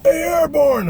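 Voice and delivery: high, loud, drawn-out holler; shouted call. Retold or imitated. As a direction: holler it across a field. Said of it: "all of a sudden I hear this".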